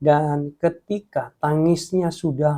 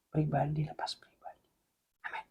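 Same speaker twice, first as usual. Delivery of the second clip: soft whispered close; 12 dB quieter than usual. Real, not acted. Preacher stresses one by one, whispered. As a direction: breathe it out quietly. Intimate closing of thought.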